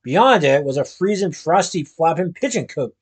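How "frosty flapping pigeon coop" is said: plain reading voice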